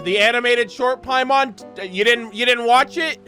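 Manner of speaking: usual speaking voice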